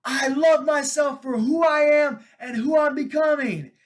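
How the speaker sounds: put-on high, loud kid's declaration; shouted affirmation. Dad voicing his three-year-old yelling affirmations, high and loud. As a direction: proclaim it at the top of your lungs. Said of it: "then he goes".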